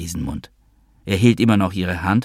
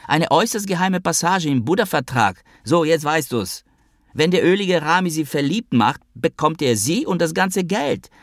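higher, lightly put-on character voice in a quarrel; lightly voiced squabbling lover; 10 semitones higher than usual. Noah bickers with Natascha in the taxi, higher voice. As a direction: play the petulant partner, pitched up.

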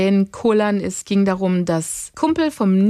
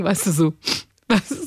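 ordinary talk, nothing marked

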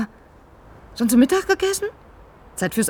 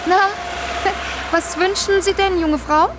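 cheery greeting; 8 semitones higher, slower than usual. Acted. Vendor greets customer brightly.

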